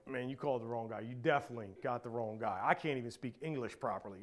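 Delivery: low, soft, quickly spoken excuse; self-doubting protest. Retold or imitated. Preacher retelling his reply to God's call, quietly doubtful. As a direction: murmur a reluctant excuse.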